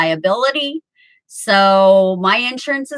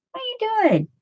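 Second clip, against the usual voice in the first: high cooing pet talk, much higher; talking to the cat; 11 semitones higher, 3 dB quieter, much faster than usual. Real, not acted. Baby talk to her cat.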